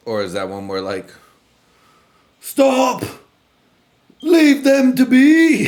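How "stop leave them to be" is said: high, loud hammed-up proclamation; theatrical shouted order, stretched out; mock official's command. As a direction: cry out slowly to halt a duel. Acting official halting the duel.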